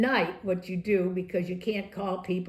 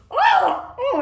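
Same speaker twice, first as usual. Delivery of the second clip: laughing shriek; 9 dB louder than usual. Retold or imitated. Steny laughing, falls off stool.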